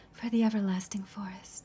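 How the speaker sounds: whispered, delicate period-drama voice; breathy, old-fashioned Lauma. Shown as a demonstration. Actor showing how the character talks about the forest, breathy whisper. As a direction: breathe it out, smooth and hushed. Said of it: "she's always talking about the forest".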